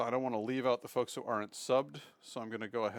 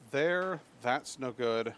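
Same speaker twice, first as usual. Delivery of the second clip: ordinary delivery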